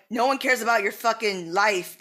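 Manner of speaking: ordinary talk, nothing marked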